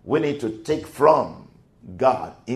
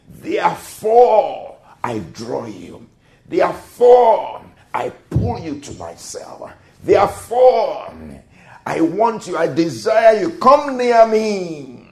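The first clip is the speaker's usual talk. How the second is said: proclaiming shout; 6 semitones higher, 5 dB louder than usual. Acted. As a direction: call it out like a summons. God's call proclaimed.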